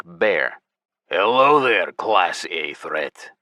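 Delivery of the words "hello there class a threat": Hinterleaf voice. A narrator's reading. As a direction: drawl it out mockingly. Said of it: "Hinterleaf exclaimed".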